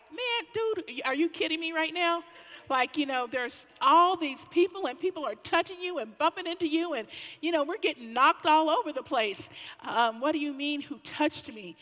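high, loud, exasperated disciple; blurting Peter. Retold or imitated. Peter scoffing at Jesus over the crowd, high and exasperated. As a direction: blurt it out in disbelief. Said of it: "blurt out like".

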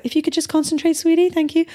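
high, sugary sarcastic politeness; sweet fake-calm teacher. Teacher to pupil, sugary fake-polite request. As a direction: turn sickly sweet. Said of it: "And it's like".

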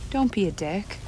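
calm soft voice. Shown as a demonstration. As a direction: say it calmly and softly, no bite. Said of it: "I said it with a calm, soft voice".

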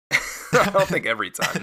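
chuckling through the words; laughing. Real, not acted. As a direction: laugh while answering. Amused answer to host's question.

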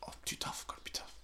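whispered to himself, very soft; hushed inner voice. Retold or imitated. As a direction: breathe the words like a private mantra. Repeating the rule to himself.

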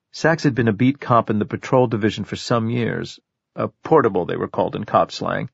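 usual speaking voice

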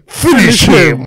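Finish him shout. Pretend. Game announcer catchphrase shouted.

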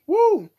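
high, loud whoop; short, high celebratory holler; cheering whoop. Real, not acted. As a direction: cheer the whole cast with a woo. Whoops after naming the cast.

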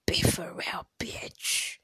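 forceful, voiceless rebuke, loud at first; mock scolding. Desk mate telling off a struggling friend, mock scolding.

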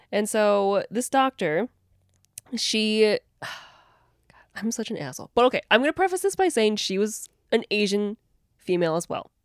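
ordinary talk

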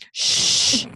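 loud, hissed mimicking shush; mock shush. Host parroting the librarian, mock shush. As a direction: throw back an exaggerated shush.

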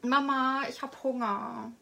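put-on high little girl whining for food; a hungry small child calling mum. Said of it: "das Kind, was [name] jetzt spielt".

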